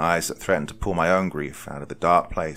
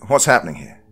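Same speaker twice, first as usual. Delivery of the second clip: loud, higher demand; raised voice; 5 semitones higher, 9 dB louder than usual. A narrator's reading. Narrator confronting the arguing men, voice raised. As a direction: demand answers over the noise. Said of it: "I raised my voice".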